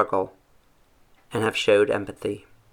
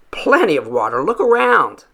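loud scoff, rising high on look around; sarcastic father; 6 semitones higher, 7 dB louder than usual. A narrator's reading. Father mocking his son's worry, loud and sarcastic. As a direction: throw it back at him loudly.

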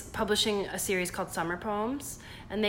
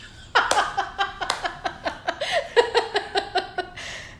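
loud laugh; 13 semitones higher, 5 dB louder than usual. Real, not acted. Laughing at roast idea.

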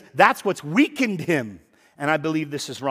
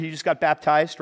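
normal talking voice

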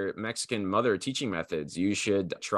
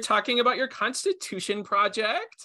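much higher, put-on girly teacher voice; 12 semitones higher, 3 dB louder than usual. Co-host trying the nail salon voice for class, high and chirpy. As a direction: chirp the lesson intro in a mock high voice.

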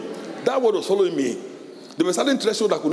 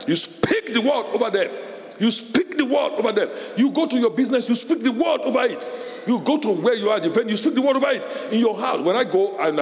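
preaching at full force; 7 semitones higher than usual. Real, not acted. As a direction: urge them to speak the word, rising. Preaching builds intensity.

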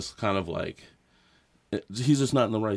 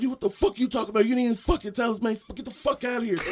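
fast, high-pitched retold tirade; cussing out; 11 semitones higher, faster than usual. Friend cussing out the traffic guard, mock angry. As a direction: rattle off the curses, imitating an angry friend. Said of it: "Homie's cussing him out".

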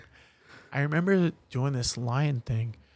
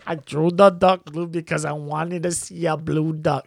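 higher, slow movie-quote voice; Billy Madison voice; 4 semitones higher, 4 dB louder than usual. Retold or imitated. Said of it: "quoting in voice".

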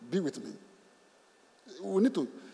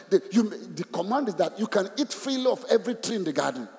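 usual speaking voice

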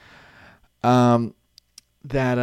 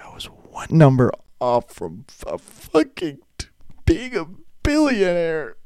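gutted lament; 6 semitones higher than usual. Retold or imitated. Winner lamenting one number off.

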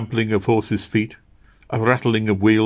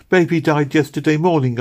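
put-on high, louder child's dry-eyed grief; tearless grief; 9 semitones higher, 4 dB louder than usual. A narrator's reading. Meg tells Missus Blossom the baby died, tearless grief. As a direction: tell it without crying. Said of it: "with tearless eyes".

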